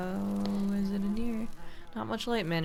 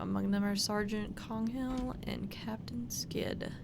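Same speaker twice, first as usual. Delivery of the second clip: hushed whisper while reading the names; 3 semitones higher, 6 dB quieter than usual. Real, not acted. Whispering to himself over an old war photo. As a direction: breathe the names out quietly.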